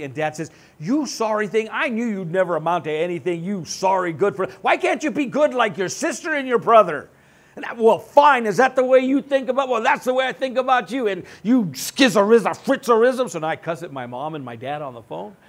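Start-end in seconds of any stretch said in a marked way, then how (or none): 0.8-7.1 louder, scornful father scolding his son. Retold or imitated. Father berating his son on the phone, loud and scornful. 7.7-9.7 much louder and higher, yelling back; angry son. Retold or imitated. Son yelling back at his father over the phone, loud. 9.7-13.3 much louder and higher, ranting curses; furious cussing. Retold or imitated. Son cussing out his parents on the phone, loud and high.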